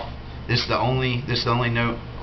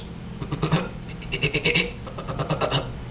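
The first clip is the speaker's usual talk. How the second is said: short forced cough from the throat; throat cough into call; 7 semitones higher than usual. Shown as a demonstration. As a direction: cough sharply with a little voice. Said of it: "coughing into the back of the call".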